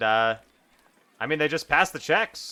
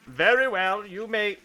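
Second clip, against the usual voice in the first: higher guard voice; kobold Kobe; 8 semitones higher, 3 dB louder than usual. Acted. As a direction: grant passage stiffly. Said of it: "Kobe says".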